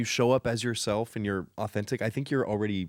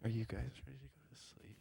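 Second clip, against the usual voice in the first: barely audible, higher bedtime murmur; playful sleepy hush; 4 semitones higher, 15 dB quieter than usual. Shown as a demonstration. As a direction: lull the listeners very softly. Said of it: "start talking like this".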